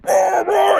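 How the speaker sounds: high shouted fake roar; mock roar. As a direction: roar like a wounded brute. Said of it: "and he's like".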